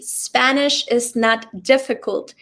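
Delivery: ordinary delivery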